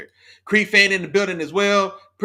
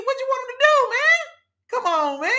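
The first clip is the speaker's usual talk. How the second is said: much higher pleading demand; exasperated high plea. Real, not acted. Pushing back at chat user Isaiah, exasperated high plea. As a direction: throw the question up high in disbelief.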